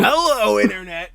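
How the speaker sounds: high, loud bright YouTube host greeting; MatPat. Retold or imitated. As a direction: open the show like a hyped YouTuber. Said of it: "MatPat Theory".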